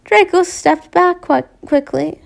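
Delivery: ordinary delivery, nothing marked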